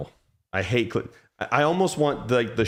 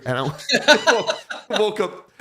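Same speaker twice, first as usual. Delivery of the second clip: laughing; 8 semitones higher, 4 dB louder, much slower than usual. Real, not acted. Laughing at his own story.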